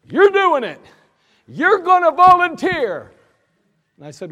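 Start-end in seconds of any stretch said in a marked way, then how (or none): 0.0-3.1 very high, loud slow shout; so mad. Retold or imitated. Sergeant yelling at the soldier that he will volunteer. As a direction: order him with rage. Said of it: "he got so mad".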